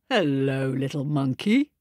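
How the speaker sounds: low, calm godly voice; Papa God. A narrator's reading. Papa God greeting the little monkey in a low voice. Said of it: "said Papa God".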